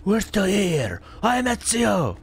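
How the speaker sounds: high, hammed-up heroic cry; playful parody of the hero's battle cry; mock Ezio. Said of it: "instead of him saying something like".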